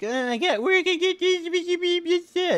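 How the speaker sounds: very high, slow mock whine; whiny student. Mocking students complaining about reading assignments, very high slow whine. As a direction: ham up a lazy student's whine. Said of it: "they would bitch and complain".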